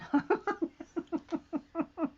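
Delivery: long high run of giggles; amused laugh. Real, not acted. Laughing at a question for viewers, amused giggle. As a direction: giggle on and on, softly.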